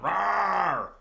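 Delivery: high, loud playful bear roar; mama bear roar. Pretend. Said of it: "mama bear's going".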